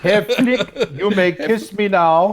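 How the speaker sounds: hammed-up, high mock German accent; fake German accent. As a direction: imitate a sitcom German woman, laughing underneath. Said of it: "speaking in a German accent".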